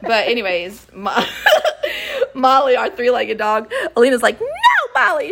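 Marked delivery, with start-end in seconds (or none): 4.4-5.3 loud, high-pitched mock shriek; imitated scream. Alina screaming at the three-legged dog, imitated scream. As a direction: yell high in alarm at the dog. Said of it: "She's screaming".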